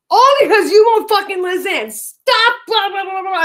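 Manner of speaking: high, loud mock yell at his girlfriend; imitating his yell. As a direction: belt it out like the angry boyfriend she's quoting. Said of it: "stop yelling at me".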